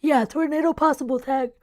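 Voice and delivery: talking through a big yawn; words stretched by a yawn. Real, not acted. Checking the tornado tag mid-yawn, yawning. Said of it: "Sorry for the ugly yawn".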